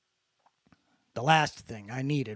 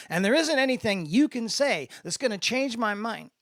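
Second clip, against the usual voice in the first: much higher, louder defiant outburst; defiant passion; 10 semitones higher, 5 dB louder than usual. A narrator's reading. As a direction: dig your heels in loudly. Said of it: "his edgy passion".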